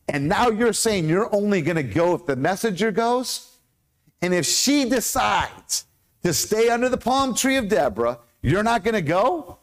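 rising, high-pitched disbelief; incredulous challenge. Acted. Preacher challenging Barak about his condition, incredulous and high. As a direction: question him as if you can't believe it.

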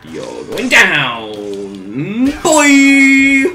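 put-on slow, loud wind-up to a high yelled "boy"; playful taunting shout. Gamer taunting an enemy as he attacks, mock battle cry. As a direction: stretch it out, then holler the last word.

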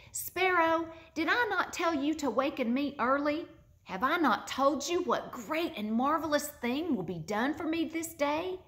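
mock-stern boy chiding a pet bird; boy scolding bird. A narrator's reading.

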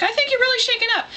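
very high-pitched concerned lady voice; high, put-on concern; imitated worried lady. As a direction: mimic a fretting lady up high. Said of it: "the lady was worried about me".

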